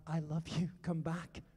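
a hint of a tender father; tender plea. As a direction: call them home gently. Voicing God's word, softly.

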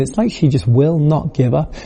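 ordinary delivery

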